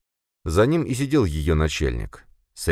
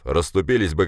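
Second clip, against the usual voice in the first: dismissive boss. Acted. Boss explains to subordinate, dismissive. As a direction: state it as obvious, unbothered. Boss's take, contradicted by Sofia.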